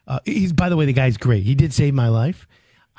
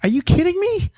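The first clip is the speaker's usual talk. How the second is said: way up high in disbelief; retelling shock at the therapist; incredulous. Retold or imitated. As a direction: squeak it in disbelief. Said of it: "I'm like".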